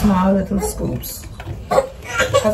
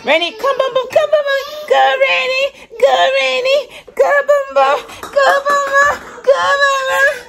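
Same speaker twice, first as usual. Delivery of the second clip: coaxing sing-song call. Real, not acted. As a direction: coax the crying little one over, high and chanting. Coaxing crying toddler to come.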